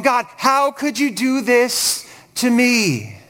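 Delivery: hammed-up wounded self-pity, stretched out; me monster. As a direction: draw it out like a self-obsessed complainer. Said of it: "He is a total me monster".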